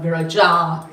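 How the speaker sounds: Viraja as vi-ra-JAA, long final vowel; wrongly stressed last syllable. Shown as a demonstration. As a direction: lean on the end of "Viraja". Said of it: "not Viraja".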